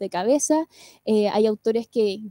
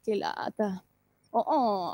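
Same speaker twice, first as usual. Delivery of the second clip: light vocal fry; 4 semitones lower, 7 dB quieter, much slower than usual. Shown as a demonstration. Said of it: "cuando decimos".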